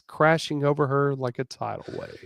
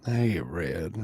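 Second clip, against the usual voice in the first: low murmur; 7 semitones lower, 3 dB quieter than usual. Acted. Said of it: "He murmured".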